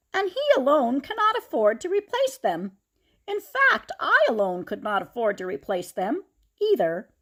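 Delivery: high, loud pulpit voice with a laugh; rally the town from the pulpit; preaching pastor. Acted. Pastor asks for collection.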